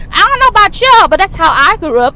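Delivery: loud, high heated outburst; real upset yell. Real, not acted. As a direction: raise your voice, upset for real. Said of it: "I don't know why I'm yelling".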